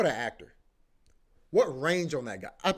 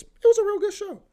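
excited squeal; 9 dB louder than usual. Real, not acted. Gushing about the show.

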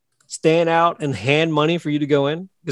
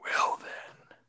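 whisper; 15 dB quieter than usual. Real, not acted. Whispered aside.

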